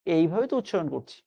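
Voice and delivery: usual speaking voice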